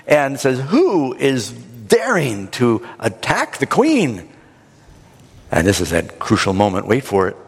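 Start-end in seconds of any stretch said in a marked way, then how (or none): 0.7-4.3 slow, emphatic royal demand; outraged king. Acted. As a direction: act out a king's indignation, word by weighty word. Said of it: "he jumps up from the table".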